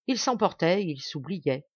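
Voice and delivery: ordinary delivery, nothing marked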